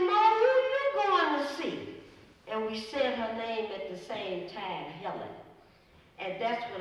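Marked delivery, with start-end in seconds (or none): 0.0-2.0 much higher, louder, slow echoed question. Retold or imitated. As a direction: toss the same question right back. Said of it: "He said".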